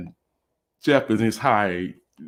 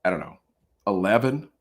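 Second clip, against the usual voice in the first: ordinary talk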